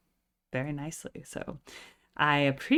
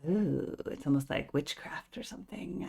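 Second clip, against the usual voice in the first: spooky whisper; 6 dB quieter than usual. Pretend. Reactor playing up the spooky song with a whisper. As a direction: hush it like a secret spell. Spooky aside about witchcraft.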